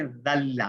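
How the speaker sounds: ذل said slowly as dhalla; dhal sound. Shown as a demonstration. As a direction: say ذل with th as in this, held l. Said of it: "ذال کے ساتھ ہے نا".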